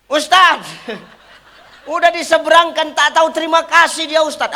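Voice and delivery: high, loud, laughing gripe to the ustaz; laughing complaint. Acted. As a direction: whine that the old woman never said thanks. Complaining congregant voiced.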